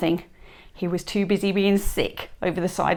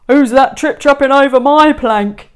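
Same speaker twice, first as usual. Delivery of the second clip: loud, higher mocking taunt; jeering; 5 semitones higher, 11 dB louder than usual. A narrator's reading. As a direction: taunt the troll on the plank loudly. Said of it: "jeered Hank Chief".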